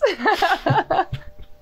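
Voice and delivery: bright burst of laughter; high laugh. Real, not acted. Host greeting, bursts out laughing.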